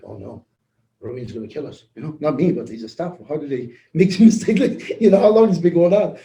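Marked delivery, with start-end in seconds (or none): 0.0-1.8 mock hushed panic. Retold panic at finding error.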